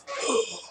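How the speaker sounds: high mock gasp; sharp intake of breath in amazement; shocked gasp. Acts the girl's amazement.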